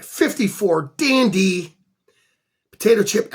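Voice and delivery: ordinary talk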